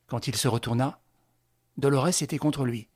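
plain reading voice

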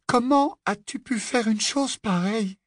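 high-pitched, dismayed wail; plaintive, voice far up; lamenting. A narrator's reading. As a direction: reproach him in distress. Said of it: "se lamenta Hermione".

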